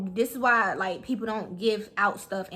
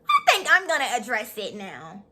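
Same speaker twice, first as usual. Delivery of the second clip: mock prissy. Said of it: "she like".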